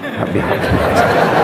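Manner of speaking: breathy whispered blessing; whispered prayer. Shown as a demonstration. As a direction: whisper it into the child's ear.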